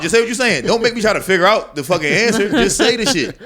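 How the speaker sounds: exasperated shout. Retold or imitated. Talking back at a guest who dodges answers, exasperated shout. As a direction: bark at him to just say it. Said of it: "It's like".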